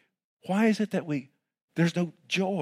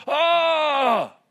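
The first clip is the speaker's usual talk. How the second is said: high excited yell; fan's shout; 14 semitones higher, 5 dB louder than usual. Retold or imitated. Dallas Cowboy fan cheering, a high shout.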